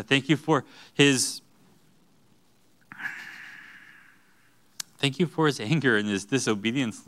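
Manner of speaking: earnest spoken prayer. Acted. Pastor praying for the congregation after the sermon, earnest. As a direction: pray to the Lord in earnest.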